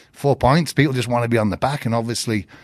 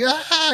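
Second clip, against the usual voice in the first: high mock dog yelp; imitated yelp. Student yelps like a dog.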